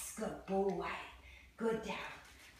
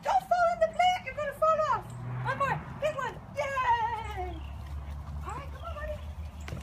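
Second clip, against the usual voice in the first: high puppy talk. Real, not acted. Trainer guiding her puppy down the steps, high and sing-song. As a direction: coo at the puppy as he climbs.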